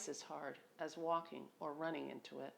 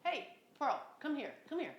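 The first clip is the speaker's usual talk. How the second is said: high call to a dog; 7 semitones higher than usual. Real, not acted. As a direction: call the dog over, pitched up. Calling second dog over.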